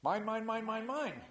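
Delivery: put-on sing-song like a toddler grabbing toys; grabby baby. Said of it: "Just like a baby".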